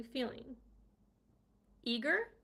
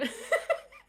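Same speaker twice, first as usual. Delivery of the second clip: high, loud laugh; high burst of laughter; 7 dB louder than usual. Real, not acted. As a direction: crack up at yourself. Laughs at own yawn.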